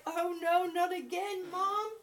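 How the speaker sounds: high, whiny voice for the cat, laughing and half-crying; cat's voice, whining. Pretend. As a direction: whine like a reluctant cat begging mom. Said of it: "He's like".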